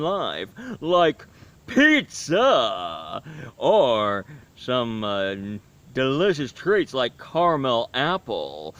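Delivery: slow, drawn-out gourmand werewolf voice; hammy food-loving werewolf, booming at first; Harry the werewolf. Acted. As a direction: savor every treat, stretching the words and humming with relish. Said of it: "he talks like this".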